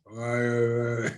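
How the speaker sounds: spaced-out drawn-out drone, imitated; mock zoned-out reply on the phone; drugged-out talk. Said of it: "I stopped talking like that".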